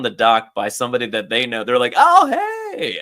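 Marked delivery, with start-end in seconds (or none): 2.0-3.0 much higher, loud happy hello; excited greeting. Retold or imitated. Friend seeing them on the dock, high excited hello. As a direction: call out like spotting old friends.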